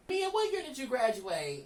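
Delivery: loud, high call to Mia. Real, not acted. Asking Mia her graduation year, calling out loudly. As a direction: holler the question to someone off camera. Calls out to someone off camera.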